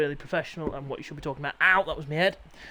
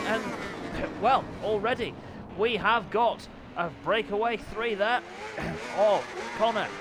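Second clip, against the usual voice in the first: lively play-by-play commentator voice; upbeat live bike race commentary; 4 semitones higher than usual. Acted. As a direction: call the race like a TV motorsport commentator.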